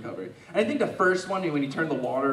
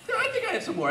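laughing; 4 dB quieter, faster than usual. Real, not acted. Repeats the line, laughing.

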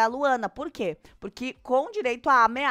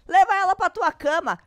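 acted indignant outburst, higher and louder; indignant retort; 6 semitones higher, 6 dB louder than usual. Luana snaps back at Zac in a retold quarrel, indignant. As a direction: throw it back like a mock quarrel.

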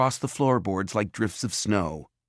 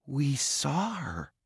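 softer, slow and hushed; softly; 6 semitones higher, 6 dB quieter, much slower than usual. A narrator's reading. Jared sits on the ruined couch and speaks softly in shock. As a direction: say it quietly, stunned. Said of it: "Jared said softly".

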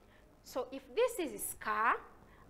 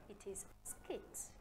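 whispered; 4 semitones lower, 12 dB quieter than usual. Shown as a demonstration. Softly repeating word.

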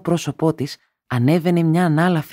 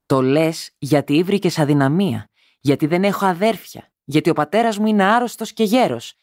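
building higher, louder fury; fierce anger; 6 semitones higher, 3 dB louder than usual. A narrator's reading. Wife turning fierce on her husband, building higher and louder. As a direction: let it swell into a fierce charge. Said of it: "αγριεύοντας και εκείνη".

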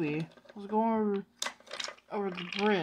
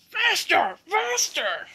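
high, loud shout; yell; 10 semitones higher, 7 dB louder, much slower than usual. Acted. Truck Scruffy goading Oliver to speed up, yelling. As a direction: urge the train on with a high yell. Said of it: "yelled Scruffy".

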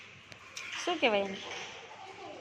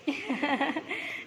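short burst of laughter; 5 dB quieter than usual. Real, not acted. Teacher chuckling during a child's turn, laugh. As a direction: laugh briefly, without words.

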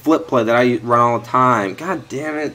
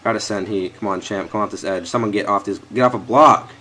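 ordinary talk, nothing marked

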